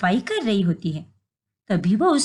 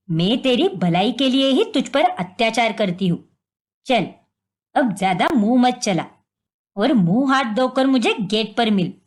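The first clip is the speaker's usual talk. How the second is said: stern mother-in-law; 4 semitones lower than usual. Acted.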